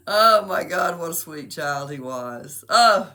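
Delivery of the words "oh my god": normal talking voice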